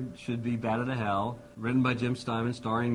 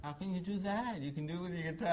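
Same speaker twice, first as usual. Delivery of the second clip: put-on higher and softer, lightly childlike; childlike disbelief; 8 semitones higher, 4 dB quieter than usual. [name] asking Todd how, lightly childlike wonder. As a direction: ask in wide-eyed wonder like a small kid. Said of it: "I felt like a four-year-old".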